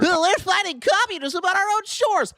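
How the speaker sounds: high-pitched mock yell; mocking shouted echo. Mockingly repeats the clip just played.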